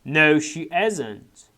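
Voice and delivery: each word stretched out; very slow model reading. Shown as a demonstration. Teacher reading the answers to students, very slow. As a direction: spell the answers out slowly. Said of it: "teacher models textbook answer".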